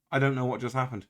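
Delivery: ordinary talk, nothing marked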